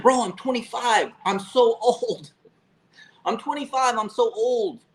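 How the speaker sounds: high-pitched, drawn-out self-mocking wail; mock whining. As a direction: plead like you're hopelessly past it, hammed up. Retelling his panicked excuse to cousin.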